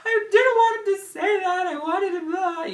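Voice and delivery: high, loud, sobbing half-sung wail; tearful wail. Retold or imitated. Retelling her upset to the husband, a high tearful wail. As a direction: wail it like you're crying again. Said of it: "And I'm like".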